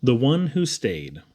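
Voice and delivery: plain reading voice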